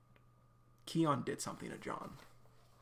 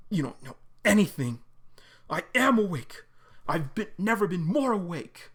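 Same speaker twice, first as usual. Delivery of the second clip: loud defiant shouting; John snapping back at Sarah, shouting; angry shout; 4 semitones higher, 7 dB louder than usual. Acted. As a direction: bite back at her, loud.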